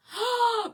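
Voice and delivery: excited gasp. Real, not acted. Reacting to a viewer's idea.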